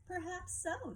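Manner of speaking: lightly voiced lobster, high and easy; a hint of a carefree lobster shrugging it off. A narrator's reading. Lobster brushing off the crab's warning, lightly voiced and high. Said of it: "said Lobster".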